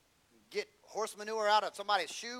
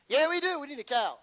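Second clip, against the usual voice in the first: imitating insistent wife; 9 semitones higher, 8 dB louder than usual.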